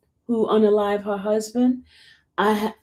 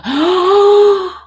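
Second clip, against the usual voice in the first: a sudden loud gasp of shock; sharp gasp; 10 semitones higher, 9 dB louder than usual. Real, not acted. Reading shocking news in the chat, sharp gasp. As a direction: gasp out loud as if caught off guard.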